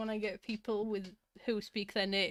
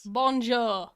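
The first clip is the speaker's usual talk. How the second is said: mock French accent; 7 dB louder than usual. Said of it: "Missus Sick with a French accent".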